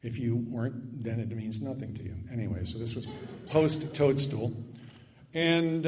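normal talking voice

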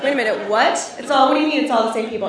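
usual speaking voice

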